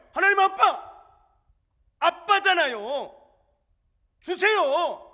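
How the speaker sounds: high, loud, slow plea to God as daddy; bold childlike prayer. Shown as a demonstration. Believer praying to God as daddy, high bold slow plea. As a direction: ask God boldly like a child asks daddy. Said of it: "이런 담대함을 가지라는 거예요".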